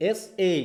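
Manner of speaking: এস এ split as "ess" then "ay", weight on "ess"; stress on first syllable. Shown as a demonstration. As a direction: keep "ess" apart from "ay" in এস এ, stressing "ess". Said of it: "মানে কি?".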